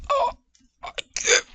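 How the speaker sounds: breathless strangled whisper; gasping. Retold or imitated. The choked girlfriend pleading, strangled whisper. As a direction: squeeze it out with no air.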